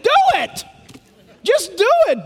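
high-pitched insistent cry; urgent exhortation. Real, not acted. Preacher urging the hesitant listener.

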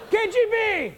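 high mock alarm cry; imagined Russian captor. Grandma imagined calling secret police on an American, mock cry. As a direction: call it out high, as a comic accusation. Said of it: "I thought she was saying".

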